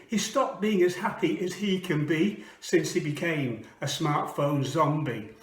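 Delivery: rhythmic poetry reading; poem recitation. Acted. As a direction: perform it like spoken word. Performs a comic poem.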